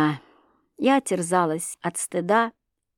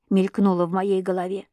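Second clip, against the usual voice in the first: ordinary delivery, nothing marked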